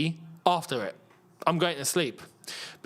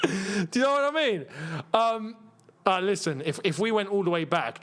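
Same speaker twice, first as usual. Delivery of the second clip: usual speaking voice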